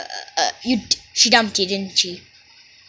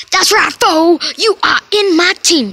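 higher, louder put-on talk; odd new way of talking; 6 semitones higher, 6 dB louder than usual. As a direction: talk in a strange new style. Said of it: "you don't talk like that".

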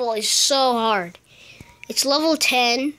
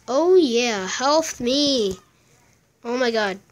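normal talking voice

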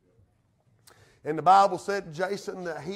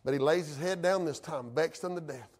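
ordinary talk

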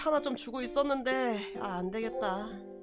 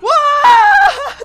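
shrieking laugh; 17 dB louder than usual. Real, not acted. Laugh shout.

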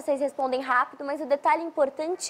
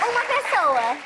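squeaky helium-filled voice. Real, not acted. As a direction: talk right after a gulp of helium. Inhaled helium balloon game.

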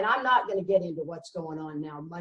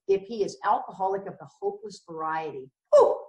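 ordinary talk, nothing marked

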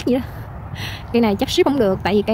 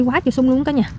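usual speaking voice

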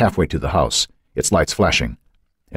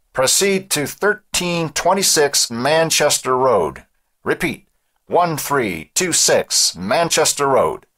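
slow, high radio dispatch voice; police dispatcher; 10 semitones higher, much slower than usual. A narrator's reading. Dispatcher sends units to an address over the scanner, slow high radio voice. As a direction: read out the address slowly as a dispatcher.

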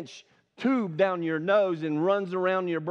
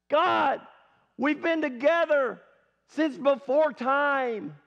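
anguished cry; 5 semitones higher, 4 dB louder than usual. Acted. Jesus on the cross crying to God, anguished outcry. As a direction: call out as if abandoned.

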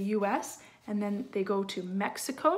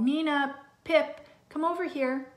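calling out; 6 semitones higher, 4 dB louder than usual. Acted. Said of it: "Mom and Dad called".

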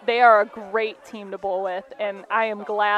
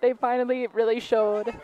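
teary; 2 semitones higher than usual. Real, not acted. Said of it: "I'm gonna cry".

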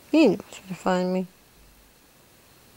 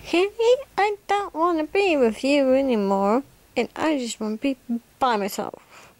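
mock sister voice; 6 semitones higher than usual. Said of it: "she said".

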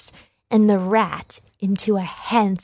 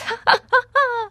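high, mocking sisterly laugh; mean cackle. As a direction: cackle down at Cinderella. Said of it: "The sisters laughed".

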